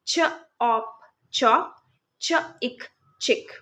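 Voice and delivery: slow segmented phonics blend, ch then in then chin; sound-by-sound blending. Shown as a demonstration. Teacher to kids, slowly blending ch-words sound by sound. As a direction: break the word चिन into च, इन, then blend slowly. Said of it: "च sound beginning में है".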